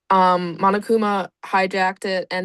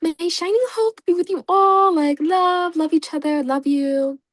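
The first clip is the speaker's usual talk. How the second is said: much higher, sugary little mascot; sweet mascot voice; 8 semitones higher than usual. Retold or imitated. Quoting Usami's greeting to the students, high sugary mascot voice. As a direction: chirp it like a tiny cute rabbit mascot. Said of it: "she's like".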